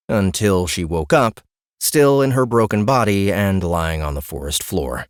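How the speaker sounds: usual speaking voice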